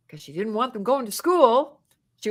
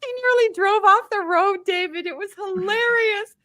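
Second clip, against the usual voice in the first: high laughing narration; telling it through laughter; 10 semitones higher than usual. Real, not acted. Laughing while telling story.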